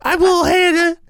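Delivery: high, loud mock little-kid voice; Lil Hannah. Cohost imitates little Hannah talking Fight Club, high silly kid voice. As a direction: pipe up as a silly Brooklyn kid. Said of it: "I don't know why I sound like this".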